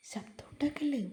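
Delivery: hushed, breathy warning whisper; soft whisper. A narrator's reading. Girl hushing her sister-in-law near the nest, whispering. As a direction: whisper so the nesting bird is not disturbed. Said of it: "Ammalu പതിയെ പറഞ്ഞു".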